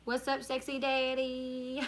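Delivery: mock flirty. Mock flirty message to a man.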